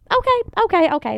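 high, hurried placating okays; placating vet. Retold or imitated. Owner imitating vet, high placating okays. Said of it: "imitating vet backing down".

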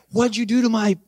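healed man asking in wonder; amazed disbelief. Retold or imitated. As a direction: marvel at the pain gone.

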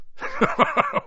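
high breathy chuckle; a big amused chuckle. A narrator's reading. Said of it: "Hagrid chuckled".

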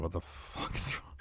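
panicked whisper under the breath. Retold or imitated. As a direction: whisper it in alarm so she can't hear. Retold inner panic at bar.

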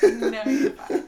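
slow, laughing reassurance. Real, not acted. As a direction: laugh while saying it.

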